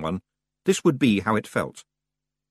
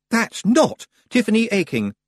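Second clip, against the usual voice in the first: put-on high, louder boy voice; shocked realisation; startled lad; 12 semitones higher, 5 dB louder than usual. Roland steps back from the waking girl, startled.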